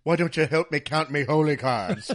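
loud, hammed-up Irish accent; exaggerated Irish accent, projected loudly; [name]'s terrible Irish brogue. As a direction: do a bad, mocking Irish brogue. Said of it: "That terrible Irish brogue".

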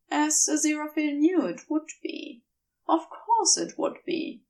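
ordinary delivery

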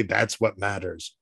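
ordinary talk, nothing marked

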